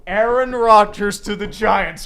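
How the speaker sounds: slow, loud insistent demand; emphatic demand. Real, not acted. Fan demanding Rodgers, slow and loud. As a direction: declare it loudly and slowly.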